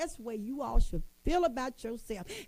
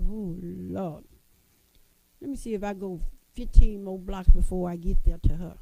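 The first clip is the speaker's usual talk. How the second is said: reluctant husband; 5 semitones lower, 7 dB louder than usual. Retold or imitated. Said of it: "our men be like".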